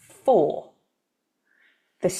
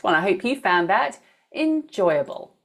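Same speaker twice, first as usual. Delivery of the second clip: ordinary delivery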